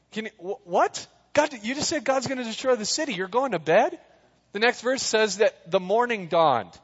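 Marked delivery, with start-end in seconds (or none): 0.1-4.0 higher, fast disbelief; can't believe what he just heard; incredulous. Real, not acted. Preacher incredulous at Lot.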